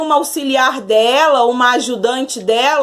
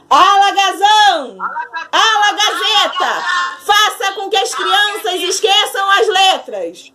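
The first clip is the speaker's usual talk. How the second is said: witch spell shout; 5 semitones higher than usual. Acted. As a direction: call out the spell with the class. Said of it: "Todo mundo comigo".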